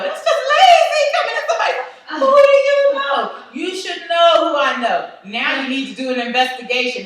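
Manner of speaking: high, loud, fast indignant rant; loud laughing rant. Retold or imitated. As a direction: tear into the lazy nurse, cracking up. Ranting at the nurse, laughing.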